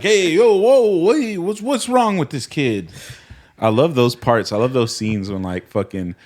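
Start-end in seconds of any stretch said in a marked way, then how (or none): 0.0-2.9 loud, high New York mobster yelling; Sopranos mobster voices. Retold or imitated. Friend acting out the Gabagool scene, loud mobster yell. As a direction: shout it like outraged wiseguys at the table. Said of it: "And everyone's like".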